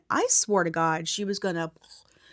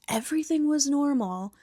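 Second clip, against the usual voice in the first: mock snap; 6 semitones higher than usual. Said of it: "Bear snaps and yells".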